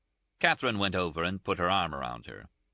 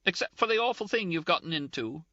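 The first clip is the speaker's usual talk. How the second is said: high, tearful protest, a touch of sobbing; 12 semitones higher than usual. A narrator's reading. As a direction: protest through light tears. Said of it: "Ferguson sobbed".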